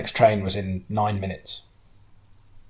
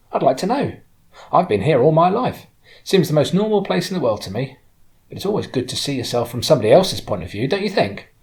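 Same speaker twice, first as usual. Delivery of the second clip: higher, lively voice of a local woman; friendly woman driver, curious; 7 semitones higher, 6 dB louder than usual. Acted. Lifelong local asking an outsider's view, curious and higher. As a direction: coax an opinion out of a shy passenger.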